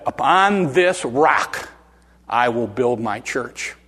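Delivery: slow and weighty, dropping at the end; solemn declaration. Retold or imitated. Jesus answering Peter's confession, slow solemn pronouncement.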